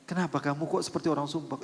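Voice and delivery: normal talking voice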